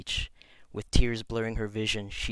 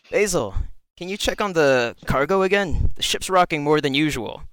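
robed teacher voice; 6 semitones higher, 8 dB louder than usual. Acted.

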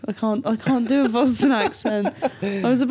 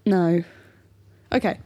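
normal talking voice

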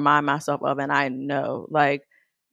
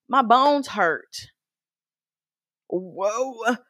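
put-on slow, loud complaining old-timer; mock old retiree; 6 semitones higher, 4 dB louder, much slower than usual. Imagined retiree complaining about aching bones, slow and loud.

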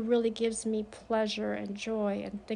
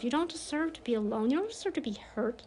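ordinary talk

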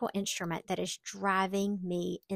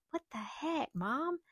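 daughter; 7 semitones higher, 6 dB quieter than usual. Retold or imitated. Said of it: "my daughter was like".

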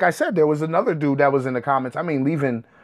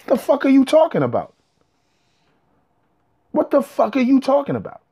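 exasperated disbelief; 10 semitones higher than usual. Real, not acted. Exasperated rhetorical question.

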